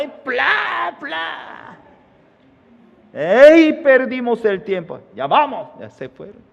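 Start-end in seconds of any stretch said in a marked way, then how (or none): 0.0-1.8 high shouted pla pla; shouted slap sounds. Acted. Slaps imitated. 3.1-5.7 loud, high call to go. Acted. Thieves call to leave.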